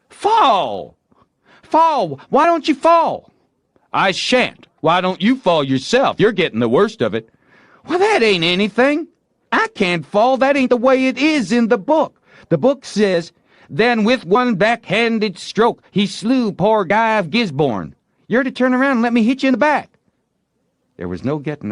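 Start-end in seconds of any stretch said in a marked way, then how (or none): 0.1-3.3 very high, loud frustrated yelling; shout. A narrator's reading. Tom yelling at Joe to fall in the game, loud. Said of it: "Tom shouted". 3.9-7.3 high, loud stubborn retort; defiant boy. A narrator's reading. Joe refusing to fall in the duel, loud and stubborn. 7.9-20.0 very high, loud insistent arguing; indignant boy. A narrator's reading. Tom insisting Joe follow the book, loud and indignant.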